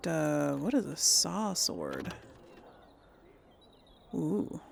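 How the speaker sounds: normal talking voice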